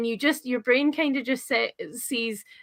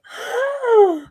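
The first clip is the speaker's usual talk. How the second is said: shocked gasp; 13 semitones higher, 7 dB louder than usual. Retold or imitated. Reacting to a shock in book.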